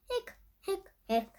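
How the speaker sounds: high little hiccups; hiccup sounds. A narrator's reading. Narrator voicing the skeleton's hiccups, high and light. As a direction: squeak out each hic like a hiccup.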